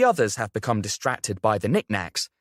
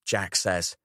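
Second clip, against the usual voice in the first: ordinary delivery, nothing marked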